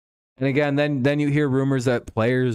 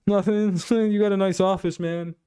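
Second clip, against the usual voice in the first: pitched up, nervous mock reply; an awkward interviewee, nervous and pitched up; 7 semitones higher than usual. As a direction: play the squirming candidate, voice up high. Nervous candidate answers in skit.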